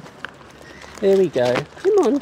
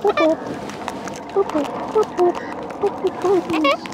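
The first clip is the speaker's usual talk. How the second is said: high whoop call. Real, not acted. Calling birds in.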